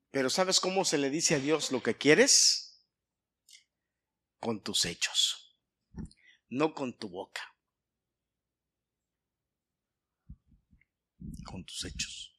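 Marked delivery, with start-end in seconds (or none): 4.4-12.3 low, soft and deliberate, with long pauses; grave, hushed answer. Real, not acted. Preacher tells the congregation how to ask God, hushed. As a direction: let it sink almost to a whisper.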